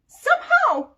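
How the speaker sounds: high, loud amazement; can't believe it; amazed. Real, not acted. Amazed the vacuum worked.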